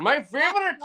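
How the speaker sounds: high-pitched put-on Bangladeshi voice; mock Bangladeshi accent. Podcaster plays a listener tuning in, high mock Bangladeshi accent. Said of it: "That's how they sound".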